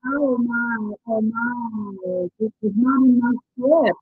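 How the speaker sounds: usual speaking voice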